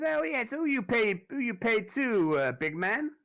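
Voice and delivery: high, loud, taunting thug voice; slow it down and needle the big man; high mocking river gangster; [name]. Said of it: "and says".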